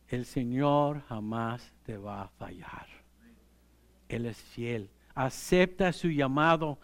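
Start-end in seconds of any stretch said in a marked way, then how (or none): 0.1-4.9 whisper. Real, not acted. Preacher whispers a promise.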